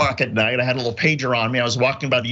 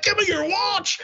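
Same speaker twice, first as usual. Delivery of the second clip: mock robber demand; slower than usual. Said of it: "He's like".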